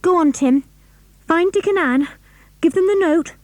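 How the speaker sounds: high, pressing orders; girl urges her dog to fetch help; urgent. A narrator's reading. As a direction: urge the dog on.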